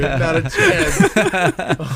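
very slow, high, laughing; drawn-out disbelief. Real, not acted. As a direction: stretch it out in amused disbelief. Teasing disbelief.

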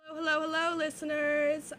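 ordinary talk, nothing marked

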